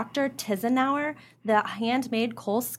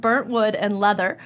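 normal talking voice

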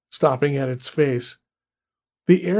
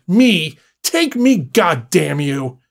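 loud, desperate shout; urgent outburst; 5 dB louder, slower than usual. Acted. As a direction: hurl it out in defiance. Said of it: "The words burst up his throat, unchecked and urgent".

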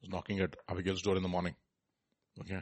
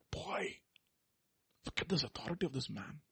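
awed hush; 4 semitones higher, 9 dB quieter than usual. Retold or imitated. Peter's thought voiced softly.

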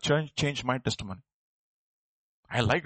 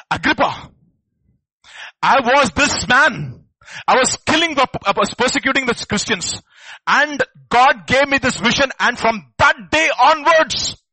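bold testimony; 8 semitones higher, 8 dB louder than usual. Retold or imitated. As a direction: proclaim it boldly before the king. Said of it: "Paul tells Agrippa".

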